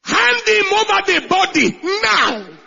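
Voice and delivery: high, loud order; commanding shout. Acted. Pilate shouting his command to the guards. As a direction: bark the order to hand it over.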